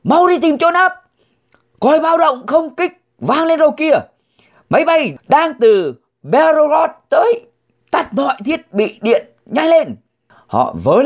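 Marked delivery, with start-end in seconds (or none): 0.0-10.0 husband yelling at family to hide from air raid, panicked shout; shouting urgently. A narrator's reading. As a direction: yell a warning to take cover now. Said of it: "chồng cô hét lên".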